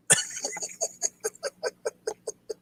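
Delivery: wheezing, airy giggle; breathless high laugh. Real, not acted. To his viewers after the Doctor Pepper joke, a wheezing laugh. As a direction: laugh almost silently, out of breath.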